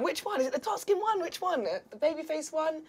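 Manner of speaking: put-on high, excited girly gossip; mock the giddy shop girls buzzing about him. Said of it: "we'd be going".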